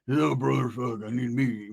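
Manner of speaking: lightly voiced sleepy man; softening, slow groggy mumble; Roach's groggy voice. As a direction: protest as if barely awake. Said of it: "Roach would lose his groggy voice".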